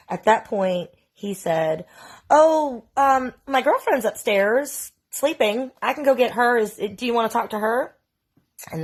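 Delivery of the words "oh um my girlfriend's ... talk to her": fake-innocent boyfriend. Abuser feigning innocence to police.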